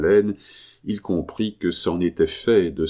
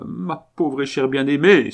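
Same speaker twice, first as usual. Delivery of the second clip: higher and louder, crying out to his wife; anguished cry; 7 semitones higher, 5 dB louder than usual. Acted. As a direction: call out her name in grief. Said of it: "s'écria le maréchal". (cri d'angoisse, plus aigu et plus fort)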